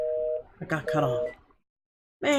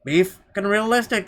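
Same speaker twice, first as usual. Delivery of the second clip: mocking quote; 7 semitones higher, 7 dB louder than usual. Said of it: "they said".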